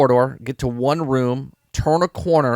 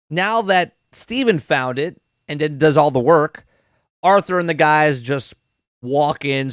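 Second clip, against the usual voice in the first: ordinary talk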